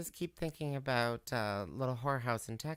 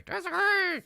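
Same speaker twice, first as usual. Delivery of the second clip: much higher and louder disagree; squeaky mock echo; 6 dB louder than usual. Host parroting co-host, squeaky high mockery. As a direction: squeak it back in a silly voice.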